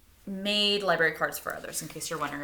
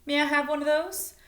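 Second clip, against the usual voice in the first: higher, louder polite request; a lightly voiced Elizabeth; 8 semitones higher, 4 dB louder than usual. Elizabeth asks the bookstore clerk, higher polite request. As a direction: ask sweetly and eagerly, a little louder.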